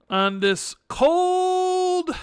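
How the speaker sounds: very high, long stretched-out "cold"; drawn-out cold. Pretend. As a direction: wail the word like you're freezing. Complaining about Chicago cold.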